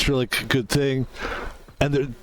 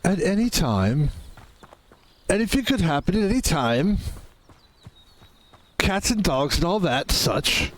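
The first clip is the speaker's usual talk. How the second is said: mock British accent; 2 semitones lower than usual. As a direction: put on a jokey British accent for the game. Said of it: "apologize to anyone from England or the U K".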